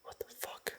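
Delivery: scared breathless whisper. Real, not acted. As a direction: whisper it, barely audible. Frightened in dark woods.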